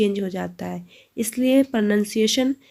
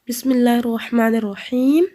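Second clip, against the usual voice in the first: slow, louder measured Quranic recitation; recitation for students; 5 dB louder, much slower than usual. Shown as a demonstration. Teacher reciting the travel dua for her students. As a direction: intone each phrase slowly and clearly. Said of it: "let's start".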